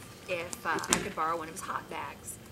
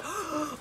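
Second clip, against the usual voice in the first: quick gasp of delight, pitched up; sharp delighted gasp; 8 semitones higher, 4 dB quieter than usual. Retold or imitated. Gasp in mock delight. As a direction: hikers gasp at the news of a motel, gasping. Hikers' eyes light up.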